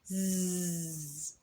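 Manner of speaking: زز as a low, humming buzz. Shown as a demonstration. Teacher buzzing the silent z like a bee. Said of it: "زي النحلة".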